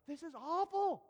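high, mock-horrified glee; scandalized gossip. Onlooker awaits a public confession, scandalized. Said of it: "the paparazzi heart of me".

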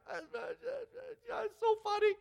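tearful stammer, very high; stammering through tears. Acted. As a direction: stutter out the words between sobs. Person crying but says it's funny.

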